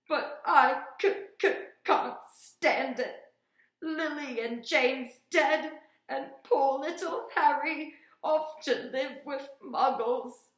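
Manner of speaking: loud, high, slow blubbering; a big man bawling through the words; wailing sob. Acted. Hagrid crying after his sobbing.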